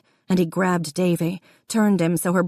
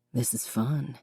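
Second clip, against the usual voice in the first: soft hushed murmur; quiet intimate whisper; 5 semitones lower, 8 dB quieter than usual. A narrator's reading. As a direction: breathe it close and low. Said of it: "he whispered".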